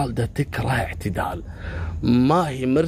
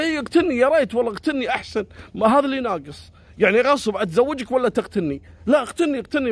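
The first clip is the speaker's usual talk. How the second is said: defiant shout; 10 semitones higher, faster than usual. Retold or imitated. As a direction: dare him to do it. Khalida defiant during fight.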